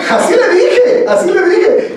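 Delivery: high, laughing insistence; amused insistence. Real, not acted. Preacher swearing to his congregation he said it, amused insistence.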